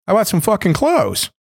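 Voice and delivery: high, indignant protest; indignant demand. Retold or imitated. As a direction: demand clothes, outraged. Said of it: "And I said".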